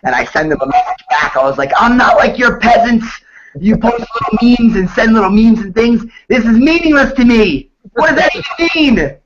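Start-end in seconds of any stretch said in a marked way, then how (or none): 1.8-9.2 high-pitched, hammed-up outraged reply; mock indignation. Replying to a meme about negativity, mock indignant outburst. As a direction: climb higher in theatrical disbelief, imitating outrage.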